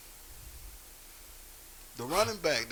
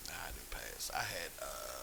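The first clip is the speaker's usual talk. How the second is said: unvoiced, much softer admission; whisper; 14 semitones lower, 11 dB quieter than usual. Real, not acted. Friend admits in a hushed whisper that he failed. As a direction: whisper it like a confession.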